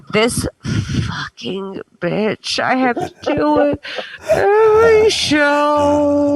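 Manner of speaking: mocking an exasperated producer; annoyed quote. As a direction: grumble it like a fed-up boss. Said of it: "She was like".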